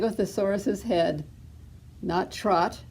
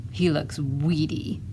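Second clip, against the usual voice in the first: low, grumbled disapproval; grumbling; 4 semitones lower, 4 dB quieter than usual. A narrator's reading. Tyrannosaurus mother disappointed in baby three, grumbling. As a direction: grumble it lower, under the narration. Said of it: "And she grumbled".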